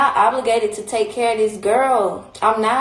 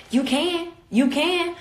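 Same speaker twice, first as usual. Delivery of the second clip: higher mimic of the friend's dare; higher mock-taunt of the friend; mimicked friend; 7 semitones higher, 4 dB quieter, slower than usual. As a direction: lightly voice the friend taunting back. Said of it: "She was like".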